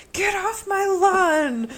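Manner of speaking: put-on high, slow old geezer shout; cranky old man yell. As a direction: holler it like a grumpy old man. Old man yelling at kids.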